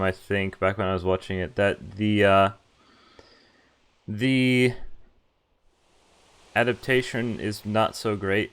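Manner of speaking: ordinary delivery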